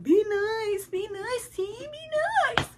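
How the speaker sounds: high mock plea, be nice; squeaky imitation of a friend pleading; imitating friend. Friend begging her to calm down, mock high plea. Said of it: "She's like".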